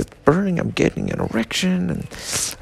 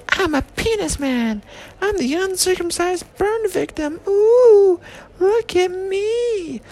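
much higher, squeaky hammed-up voice; silly penis man; slower than usual. Comic voicing a cartoonish penis man, high silly squeak.

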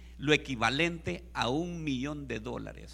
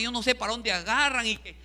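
usual speaking voice

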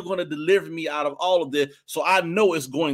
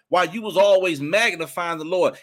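normal talking voice

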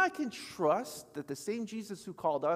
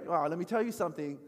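usual speaking voice

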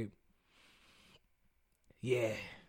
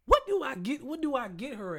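exasperated high question; 10 semitones higher than usual. Real, not acted. Baffled over a gift.

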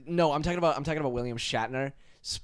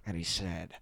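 low, hushed villain; quiet suspense before the punchline; soft creepy monologue; Joker impression; 6 semitones lower, 8 dB quieter than usual. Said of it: "Playing the Joker".

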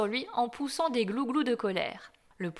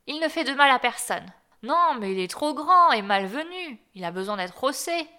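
heated reply; 4 semitones higher, 6 dB louder than usual. A narrator's reading. Mother and a duck quarrel.

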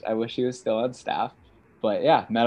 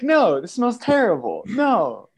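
much higher, insistent no; exasperated protest; 11 semitones higher, 4 dB louder than usual. Retold or imitated. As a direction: push back, fed up. Arguing back about the smell.

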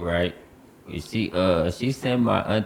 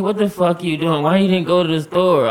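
higher-pitched retold telling-off; heated imitation, rising louder; scolding aunt; 9 semitones higher, 5 dB louder than usual. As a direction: scold him like an angry aunt.

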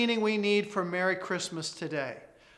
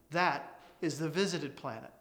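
low, grave and hushed; solemn; 4 semitones lower, 6 dB quieter than usual. Acted. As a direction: say it with quiet reverence. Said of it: "replied the senior solemnly".

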